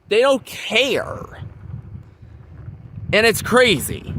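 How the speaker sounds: usual speaking voice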